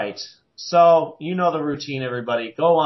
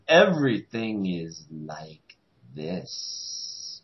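very slow, low and hushed drawl; mock impression, sinking low and soft; Captain Cold; 9 semitones lower, 5 dB quieter, much slower than usual. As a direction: imitate a villain dragging out every word. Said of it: "when he talks".

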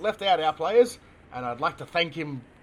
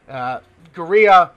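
ordinary talk, nothing marked

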